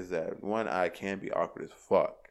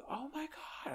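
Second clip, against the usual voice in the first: put-on shocked girl; 12 dB quieter, slower than usual. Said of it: "And she".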